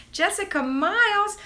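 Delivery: high, loud call of the name; excited. Real, not acted. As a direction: call it out with delight. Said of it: "I got my first greeting".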